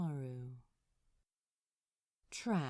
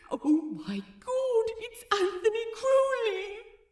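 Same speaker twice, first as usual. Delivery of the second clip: starstruck squeal; 9 dB louder than usual. Acted. Starstruck fan blurting celebrity's name.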